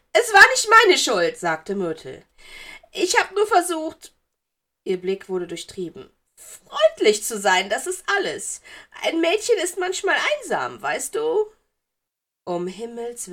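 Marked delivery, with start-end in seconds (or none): 0.1-1.4 very high, very loud protest; empörtes Geistermädchen. A narrator's reading. Ghost girl protests her innocence. 2.9-4.1 high, loud self-defence; verteidigendes Geistermädchen. A narrator's reading. Ghost girl defends herself. 6.3-11.5 put-on high, loud, coy ghost girl; durchtriebenes Geistermädchen. A narrator's reading. Narrator voices sly ghost girl.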